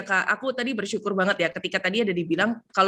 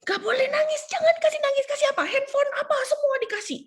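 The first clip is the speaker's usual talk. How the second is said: high-pitched mock granny; fussy grandmother. Mimicking the mother-in-law who won't let the baby cry, high and fussy. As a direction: squeak it out like a doting grandma.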